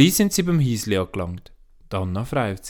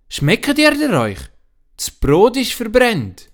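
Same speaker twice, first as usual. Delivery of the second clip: louder, higher and slow scolding; a father loudly complaining about burnt bread; 12 semitones higher, 6 dB louder, slower than usual. Acted. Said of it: "eine laute Stimme".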